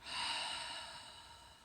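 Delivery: slow, airy exhale; long sigh. Real, not acted. Meditation leader settling into her seat, long sigh. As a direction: breathe out long and easy. Demonstrates breath while settling in.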